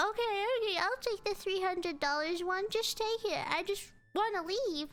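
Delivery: high, pleading mock whine; desperate. Passenger who just wants to leave, desperate. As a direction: plead to be done with it, high-pitched. Desperate caller accepting the deal.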